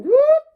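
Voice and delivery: very high, loud buzzing trumpet boops; mouth trumpet. Shown as a demonstration. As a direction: buzz the lips like a trumpet. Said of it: "and I just sing".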